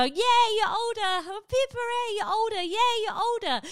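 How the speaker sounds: high, squealy birthday cheer; mock cheering. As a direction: cheer like a giddy kid.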